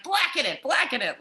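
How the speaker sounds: high, eager chant of a demand; mock demand. Guest mimicking herself ordering burnt food, high and eager.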